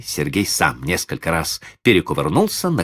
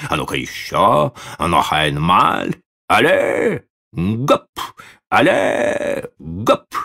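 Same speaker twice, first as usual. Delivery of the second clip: ringmaster calls; slower than usual. A narrator's reading. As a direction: shout the jump commands. Circus trainer calling.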